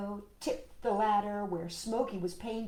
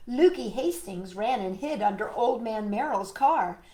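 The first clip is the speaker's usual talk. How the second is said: ordinary delivery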